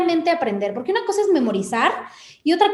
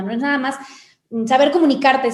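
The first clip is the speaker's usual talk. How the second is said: ordinary talk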